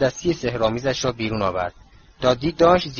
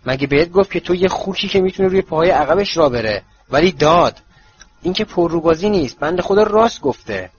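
taunting; 5 semitones higher, 4 dB louder than usual. Acted. Harry taunting Dudley.

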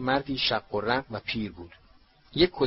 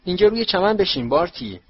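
high, loud, enthusiastic invitation; bubbling with eagerness; eager; 8 semitones higher, 7 dB louder than usual. A narrator's reading. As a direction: invite an old pal over eagerly. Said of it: "لودو با اشتیاق گفت".

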